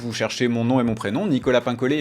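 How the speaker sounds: ordinary talk, nothing marked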